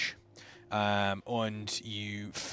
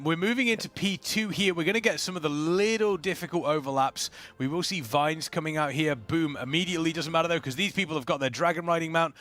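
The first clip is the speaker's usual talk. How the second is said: lively esports caster read; play-by-play commentary; 6 semitones higher than usual. Acted. Caster narrates the raid boss pull for viewers, lively play-by-play. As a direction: call the fight like a live caster.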